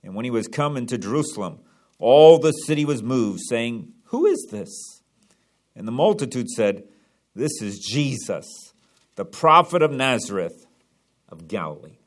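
measured, formal Bible reading; scripture reading. Acted. Pastor reading the Palm Sunday passage aloud, formal reading. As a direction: read the verses out with reverence. Reads scripture before sermon.

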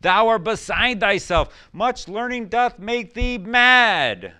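loud, high, very slow accusation. Acted. Festus shouting at Paul, loud and slow. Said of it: "Festus said with a loud voice".

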